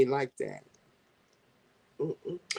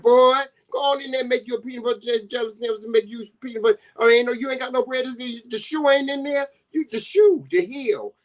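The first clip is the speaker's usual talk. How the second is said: country mama voice; 9 semitones higher, faster than usual. Retold or imitated. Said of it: "That's what we call it down home".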